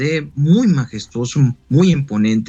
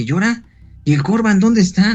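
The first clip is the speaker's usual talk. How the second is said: retold alarm; 6 semitones higher than usual. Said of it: "y sí me espanté".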